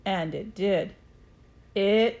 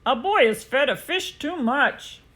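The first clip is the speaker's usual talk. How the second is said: policeman on the radio; 4 semitones higher, 4 dB louder than usual. A narrator's reading.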